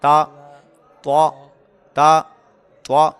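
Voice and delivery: تا kept thin, توا full and heavy with an open mouth; thin ta, heavy toa. Shown as a demonstration. Teacher repeats the تا and توا drill for students. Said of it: "منہ اور آواز کھول کر ادا کیا ہے".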